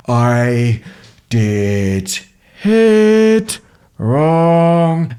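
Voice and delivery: each word slow and drawn apart, pitch jumping; super slow. Shown as a demonstration. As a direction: stretch every word slowly. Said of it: "super slow".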